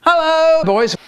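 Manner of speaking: high, loud hammed-up hello; dad hailing his sons, high and loud; dad's greeting. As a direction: greet the boys big and bright. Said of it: "And Dad said".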